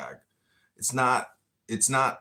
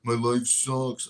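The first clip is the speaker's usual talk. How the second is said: higher, louder mock whine of the depressed youth; gloomy young people; 3 semitones higher, 4 dB louder than usual. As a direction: whine out their complaints, lightly hammed. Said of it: "And they're like".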